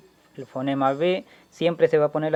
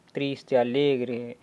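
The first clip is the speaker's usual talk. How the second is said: sad, then happy; 3 semitones lower, 4 dB quieter, much slower than usual. Shown as a demonstration. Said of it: "puedo decir pala".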